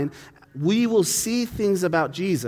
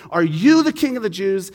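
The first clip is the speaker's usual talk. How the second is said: a touch of cynical sneer, a bit louder; 4 semitones higher, 6 dB louder than usual. Retold or imitated. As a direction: ask it with mocking disbelief. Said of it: "in some sort of a cynical tone".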